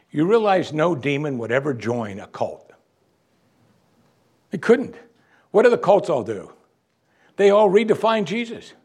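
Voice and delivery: normal talking voice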